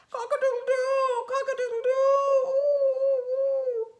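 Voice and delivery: very high, loud crowing turning to wails; a rooster crowing then sobbing ooh; crying rooster. A narrator's reading. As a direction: crow like a tearful old rooster. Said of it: "he saw a rooster crying".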